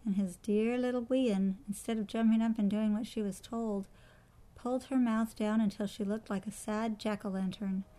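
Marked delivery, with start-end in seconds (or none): none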